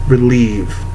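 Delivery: ordinary delivery, nothing marked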